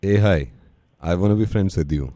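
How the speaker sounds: deep and slow like a shy creep; low shy creep voice. Acted. Prank caller flirting with woman, low slow voice.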